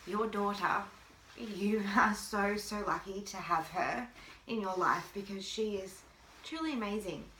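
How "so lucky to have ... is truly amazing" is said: ordinary delivery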